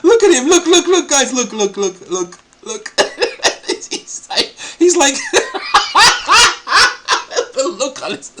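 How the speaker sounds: loud, high words breaking into real laughter; cracking up. Real, not acted. Host tells viewers to look at Brother Mort, cracking up. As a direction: laugh so hard the sentences fall apart. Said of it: "that's, it's funny".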